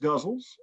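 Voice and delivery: "guzzles" said GUZ-ulz, first vowel as in cup; variant pronunciation. Shown as a demonstration. Poet showing listeners ways to name the form, "guzzles" said GUZ-ulz. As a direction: say "guzzles" to rhyme with puzzles. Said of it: "they can be pronounced".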